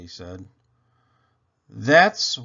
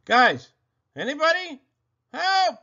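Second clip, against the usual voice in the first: much higher, louder desperate calls; calling for help; 7 dB louder than usual. Acted. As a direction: shout after the departing elves. Boy calling elves, left alone.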